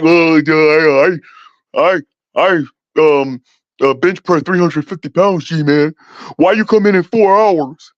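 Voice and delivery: slow, dopey mock gym-bro voice; dumb meathead. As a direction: play the dim bench-presser heckler. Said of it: "like a Neanderthal".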